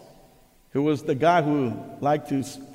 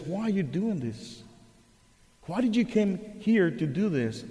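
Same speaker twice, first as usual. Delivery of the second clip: soft, higher, puzzled priest asking why; a priest gently wondering at the boy; gentle priest; 4 semitones higher, 4 dB quieter than usual. Retold or imitated. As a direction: priest asks the mocking student softly. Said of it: "the priest looked at him and says".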